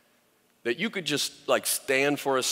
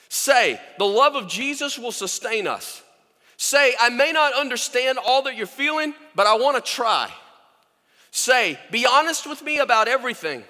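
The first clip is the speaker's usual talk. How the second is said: much higher and louder, fervent full-force preaching; impassioned preaching; 10 semitones higher, 5 dB louder than usual. Acted. Preacher urging listeners to say words of love, fervent and high. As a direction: lift them up, pour it all out.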